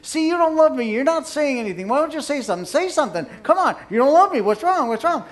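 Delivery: high, loud, relentless pestering; nagging wife getting worked up; badgering wife. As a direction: corner him with demands and accusations. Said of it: "she's still saying things".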